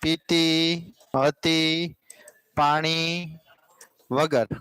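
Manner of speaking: slow, a pause after every word; word-by-word dictation. Shown as a demonstration. Teacher giving pupils a memory phrase, word-by-word dictation. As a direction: spell out the memory phrase one word at a time.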